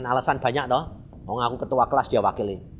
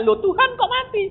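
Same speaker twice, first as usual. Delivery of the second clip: much higher, startled cry to God; shocked question; 8 dB louder than usual. Retold or imitated. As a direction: gasp it out in disbelief. Kaget orangnya sudah mati. (tanya kaget dengan nada tinggi)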